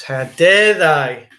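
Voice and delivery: drawn-out fake outrage at the cyclists; hammed-up how-dare-they; mock outrage.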